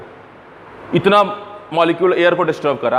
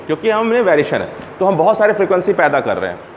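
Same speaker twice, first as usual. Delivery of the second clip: usual speaking voice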